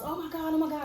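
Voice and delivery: high-pitched imitation of startled girls; retold flustered "oh my God" chatter; mock alarm. Girls reacting to a fight.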